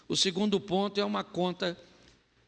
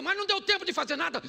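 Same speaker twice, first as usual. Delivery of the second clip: mock excuse; 10 semitones higher, much faster than usual. Staged quarrel, husband's part.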